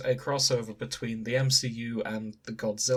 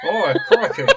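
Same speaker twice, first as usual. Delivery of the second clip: laughing; 11 dB louder than usual. Real, not acted. Laughing reply to the accent.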